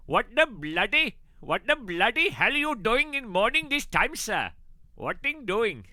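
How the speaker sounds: high, animated put-on Indian accent. Streamer reading a viewer's question, exaggerated Indian accent. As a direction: exclaim it in a mock Indian accent. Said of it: "reading that in an Indian accent".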